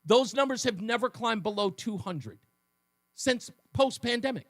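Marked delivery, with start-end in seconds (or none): none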